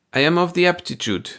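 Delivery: put-on high, lightly accented beaming reply; a hint of a proud foreign worker; beaming foreigner. A narrator's reading. Little foreign worker beaming at the foreman. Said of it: "Amenth beamed".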